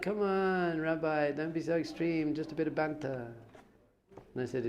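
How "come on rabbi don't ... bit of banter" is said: mock pleading. Students begging the rabbi not to be extreme, imitated wheedling. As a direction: plead like a student, easing off towards the end.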